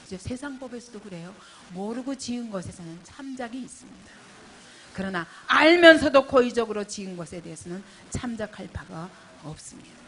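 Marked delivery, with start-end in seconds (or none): 4.9-10.0 full-force preaching. Acted. Pastor pressing the congregation, preaching at full force. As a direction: preach it out to the whole hall.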